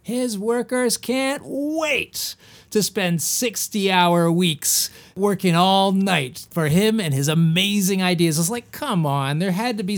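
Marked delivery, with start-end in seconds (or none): 0.0-8.4 mock gushing praise. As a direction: imitate gushing admirers, stretching the words. Mocks gushing quotes about Edison's workers.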